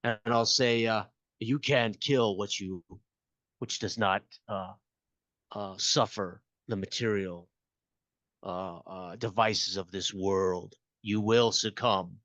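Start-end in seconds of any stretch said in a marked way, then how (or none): 1.4-12.2 demonic voice. Acted. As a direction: intone it like a demon. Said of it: "demonic, uh, voice modulator".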